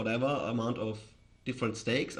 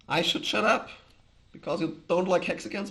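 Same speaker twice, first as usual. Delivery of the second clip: higher and louder, playfully offended; indignant surprise; 7 semitones higher, 5 dB louder than usual. Real, not acted. Streamer to a rude chat viewer, playfully offended and indignant. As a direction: protest the rude chat message in mock disbelief.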